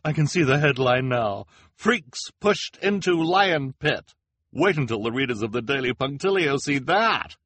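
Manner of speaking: high, loud reporter, relishing the headline; excited reporter. Acted. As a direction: announce it like a thrilling scoop. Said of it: "exclaimed the reporter excitedly".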